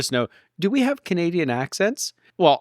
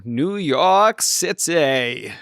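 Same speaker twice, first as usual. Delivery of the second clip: drawn-out put-on accent, vaguely Australian; much slower than usual. Co-host repeating the train's destination, mock Australian accent. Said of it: "sound a bit more like [name]. Is that the Australian thing?".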